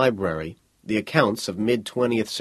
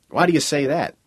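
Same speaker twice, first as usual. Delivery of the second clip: mildly irritated, pitched higher; 5 semitones higher, 4 dB louder than usual. A narrator's reading. Niels questions the visitor, mildly irritated. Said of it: "He paused, irritated".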